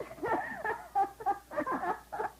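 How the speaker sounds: high, bursting laugh at the punchline; cracking up after the joke; hearty laugh. Real, not acted.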